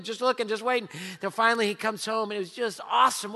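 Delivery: normal talking voice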